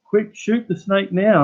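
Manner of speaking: mock high, slow plea from a frightened woman; imitating the neighbour urging him to shoot; panicky neighbour lady. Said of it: "and she's like".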